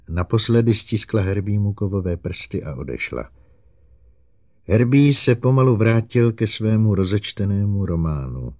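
usual speaking voice

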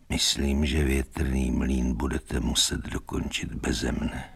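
low, soft and drained; weak voice. A narrator's reading. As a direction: speak feebly, as one lying injured. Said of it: "řekl slabým hlasem boxer". (slabým, tichým hlasem)

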